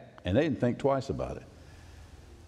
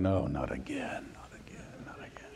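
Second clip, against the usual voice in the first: eye-rolling whisper; 10 semitones lower, 10 dB quieter than usual. Acted. Guys around Daniel muttering in a whispered groan. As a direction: sigh it out under your breath, fed up. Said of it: "rolling their eyes going".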